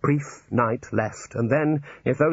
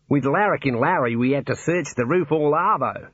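Australian warder; 4 semitones higher than usual. Acted. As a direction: play the cheery Australian warder.

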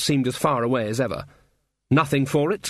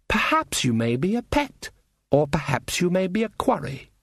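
higher, measured voice of a predator; lilting, haughty threat; toying elf menace; 5 semitones higher than usual. Acted. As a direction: weigh up your prey, pitched up. The Queen's look toying with prey.

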